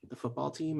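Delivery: softly voiced aside among friends; gossiping friends. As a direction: drop it well below normal volume. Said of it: "Your friends are all just like".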